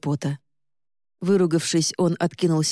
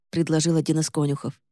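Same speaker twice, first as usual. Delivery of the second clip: ordinary delivery, nothing marked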